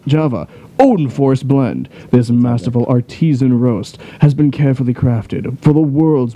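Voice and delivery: measured, dramatic promo read; announcer voice. Acted. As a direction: sell it like a superhero announcer. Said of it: "my announcer voice".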